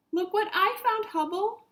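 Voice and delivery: put-on high, loud excited kid voice; thrilled young Martian showing off a find; Marty calls out his discovery, high and excited; excited child. Marty excited about found rock.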